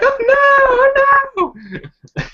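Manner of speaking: very high squealed cry of dismay; mock girlish dismay. Volleyball girl caught in the rain, mock squeal. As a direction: squeal it like a hammed-up panicking girl. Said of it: "And she's like".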